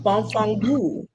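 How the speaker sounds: put-on slow Italian-accented "bonfangu"; Italian curse. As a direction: spit out "bonfangu" like an Italian nonna. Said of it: "when I heard someone say".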